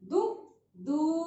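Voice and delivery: दु short, then दू drawn out very slowly; slow teacher contrast of दु and दू; short then long. Shown as a demonstration. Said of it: "sound को खींचना है".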